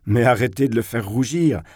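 ordinary delivery

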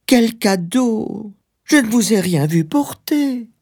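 very high, drawn-out woman's voice; high-pitched disbelief; anxious wife. Acted. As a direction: question him in a high, fretful wife's voice.

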